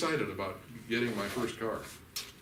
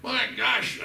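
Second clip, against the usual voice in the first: much higher and louder exasperated cry; mock angry outburst; 9 dB louder than usual. Angry parent yells at his child, mock furious. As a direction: blow up at the kid. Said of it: "I'm angry at one of the kids".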